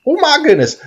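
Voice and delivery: high gasping exclamation; overwhelmed with joy; amazed. Real, not acted. Another bison.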